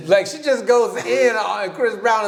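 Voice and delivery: ordinary delivery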